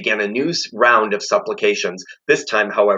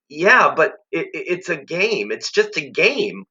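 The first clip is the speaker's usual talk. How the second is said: put-on uneasy boy protest; 7 semitones higher than usual. Harvey protesting.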